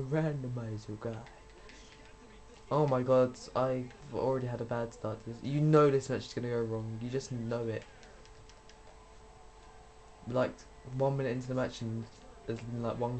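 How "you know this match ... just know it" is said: ordinary talk, nothing marked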